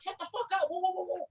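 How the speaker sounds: a playful imitation of a friend snapping; mock cuss-out. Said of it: "He like".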